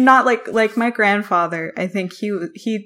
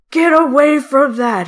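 put-on loud, high raspy old man; 7 semitones higher, 8 dB louder than usual. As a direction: scold like a grumpy old man. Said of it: "raspy old man voice".

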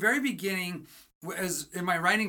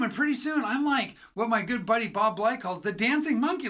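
usual speaking voice

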